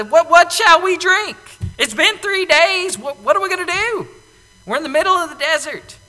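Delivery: loud, high, indignant whine; grumbling complaint. Retold or imitated. Israelites grumbling at Moses about thirst, loud and high. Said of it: "they grumble against him".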